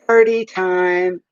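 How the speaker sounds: slow, drawn-out party cheer; party time cheer. Retold or imitated. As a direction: stretch it like a festive shout. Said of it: "you say".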